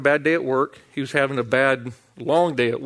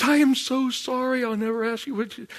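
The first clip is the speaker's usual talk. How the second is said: high, softened imitation of wife; imitated amused apology; 9 semitones higher, 4 dB quieter than usual. Wife cracking up at the counter apologises to husband, high-pitched. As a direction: apologise in a high put-on wifely voice. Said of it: "cracking up, chuckling, laughing".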